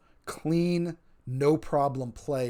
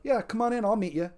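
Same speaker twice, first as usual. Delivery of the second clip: much higher-pitched, friendly invitation; eager welcome; 8 semitones higher, 3 dB louder than usual. Acted. Player inviting a newcomer into role play, eagerly welcoming. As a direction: call them over in a raised, upbeat pitch.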